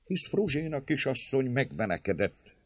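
high-pitched self-justifying; consoling himself. A narrator's reading. Servant leader alone with his thoughts, consoling himself. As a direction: reason it away to yourself. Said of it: "így vigasztalá magát".

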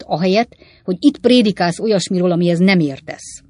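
loud, heated telling-off; angry scolding. Acted. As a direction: keep scolding him hotly. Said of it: "kiáltott fel Sam haragosan".

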